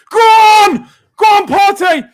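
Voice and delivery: loud high cheer urging him on; excited shouting. Real, not acted. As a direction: roar him forward. Urging a run on goal.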